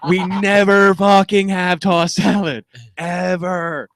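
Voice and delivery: high, loud, slow and emphatic; exasperated protest. Retold or imitated. Worker fed up with inmate's daily demand, retold exasperated protest. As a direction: spell it out in exasperation.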